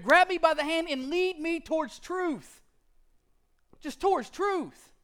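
high, loud, urgent preaching; fiery sermon build; preaching at full force. Acted. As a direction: drive it home from the pulpit.

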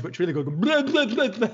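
a high, loud, silly babble of blah sounds; imitated babbling. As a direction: mimic wordless babbling, half laughing. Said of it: "trying to make noises".

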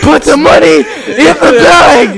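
high, loud, slow cartoon robber; bank robber. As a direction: squeal it like a cartoon bandit.

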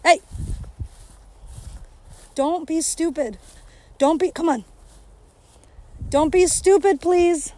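loud, high scolding; scolding the dog. Real, not acted. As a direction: snap at the dog to behave.

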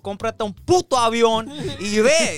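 ranting at the driver behind in Spanish; mock angry Spanish. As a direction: tell the honker off in Spanish. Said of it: "I'm like".

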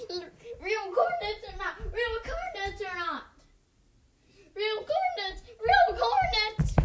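crazed, wailing repeats; wild unhinged ranting. Real, not acted. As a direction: rant it like you've lost it. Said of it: "I know I'm acting like a crazy person".

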